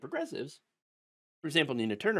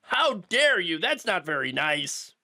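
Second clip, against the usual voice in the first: higher, louder mock outrage; hammed-up "How dare you?" with a loud high "dare"; 4 semitones higher, 4 dB louder, slower than usual. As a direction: parody a scandalized liberal, whining. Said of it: "get all upset and be like".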